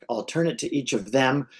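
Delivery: ordinary talk, nothing marked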